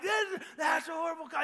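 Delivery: high-pitched heckle from the stands; yelling. Retold or imitated. Caught up in the game booing the referees, yelling. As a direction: yell along with a booing crowd. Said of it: "and I'm yelling".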